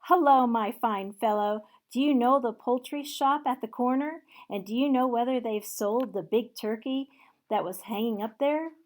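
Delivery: loud and eager; excited calling. Acted. Scrooge calling down to the boy, loud and eager. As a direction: call out to the boy with glee.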